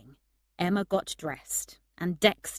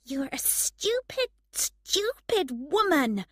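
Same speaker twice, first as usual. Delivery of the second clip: scolding herself; 10 semitones higher, 4 dB louder than usual. A narrator's reading. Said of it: "she told herself".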